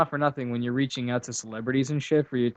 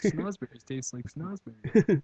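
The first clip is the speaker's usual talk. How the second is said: mock tripping; 3 semitones higher, 4 dB quieter, slower than usual. Riffing on drug trip jokes.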